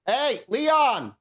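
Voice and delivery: high-pitched call across the room; shout. Acted. Connor shouting over to his friend, high shout. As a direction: holler to a friend some way off. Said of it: "and he shouts".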